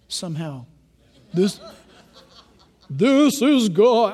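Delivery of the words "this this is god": slow, drawn-out pretend God voice; mock voice of God. Preacher jokingly imitating God for the congregation, mock-grand. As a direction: play God with every word stretched. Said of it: "I don't think God talks like that".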